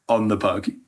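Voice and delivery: usual speaking voice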